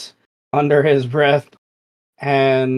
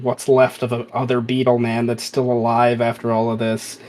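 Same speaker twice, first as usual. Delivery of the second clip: usual speaking voice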